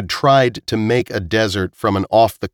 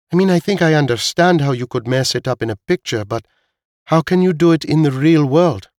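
high-pitched, baffled character voice; questioning Veek; 8 semitones higher than usual. Acted. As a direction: question it in a clearly raised pitch.

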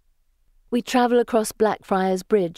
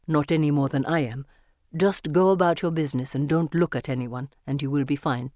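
lowered man's voice, controlled warning; Kartik, cautioning; 4 semitones lower than usual. Acted.

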